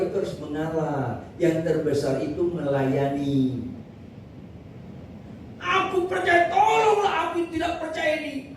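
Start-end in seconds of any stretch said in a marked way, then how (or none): 5.6-8.5 heartbroken cry. Acted. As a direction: cry out as a father begging for help. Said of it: "Hancur hati dia". (seruan memohon dengan hati hancur)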